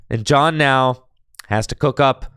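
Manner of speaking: ordinary talk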